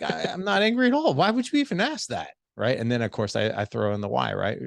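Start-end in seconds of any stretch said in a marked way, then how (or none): 0.0-2.3 very high-pitched mock indignation; hammed-up "who, me?" protest; defensive denial. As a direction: deny it in a squeaky huff. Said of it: "the tone in your voice".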